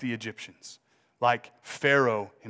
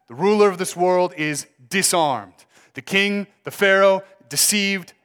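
higher, louder triumphant preaching; preaching at full force; 5 semitones higher, 5 dB louder than usual. Acted. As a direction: proclaim victory over the ruler. Climax of sermon.

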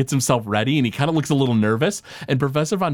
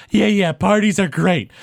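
professor's voice; 6 semitones higher, 4 dB louder than usual. Acted.

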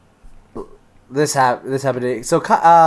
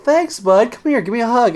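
tender uncle; 9 semitones higher than usual. Retold or imitated. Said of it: "I was like".

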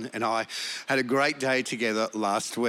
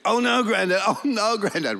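put-on higher, louder child's wail; whining grandson; 9 semitones higher, 4 dB louder than usual. Grandson in the back seat wailing at granddad. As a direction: wail it like a little boy. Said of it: "So he starts to eat a biscuit".

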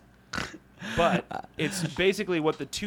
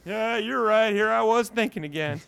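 higher, loud put-on voice; chastened worker; 7 semitones higher, 5 dB louder than usual. Steve gives in to his coworker, high character voice of a chastened worker. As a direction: back down as the scolded underling. Acted Steve meekly backing down.

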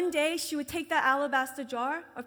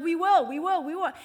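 impatient agreement; 3 semitones higher, 9 dB louder than usual. Retold or imitated.

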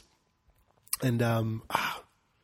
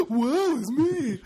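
very high, slow mock lament; dramatic sigh; 13 semitones higher, 4 dB louder, much slower than usual. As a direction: overdo the woeful moan, slowly. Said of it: "Sam sounds more like this".

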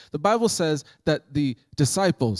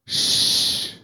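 shushing. Retold or imitated. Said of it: "standing up at the stern of the boat and going".

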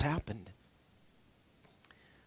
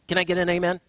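usual speaking voice

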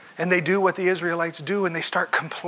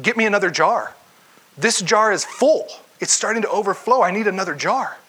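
raised, louder calls for another jar; urgent call for jar; 5 semitones higher, 5 dB louder than usual. Retold or imitated. Widow shouts to her son as the oil overflows, excited.

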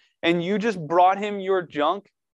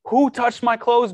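higher and louder, calling over the crowd; urgent call out; 5 semitones higher, 6 dB louder than usual. Acted. Jesus stops in the crowd and calls out to find her, urgent and loud. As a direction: make it ring out to find who touched him.

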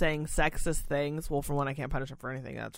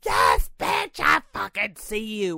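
irritated shout; 5 dB louder, slower than usual. Retold or imitated. Snapping at hair-tugging resident.

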